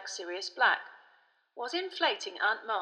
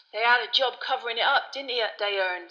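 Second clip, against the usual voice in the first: loud, chummy know-it-all conductor; Stan the chatty conductor; 4 semitones higher, 7 dB louder than usual. A narrator's reading. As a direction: play a cocky bus conductor chatting loudly.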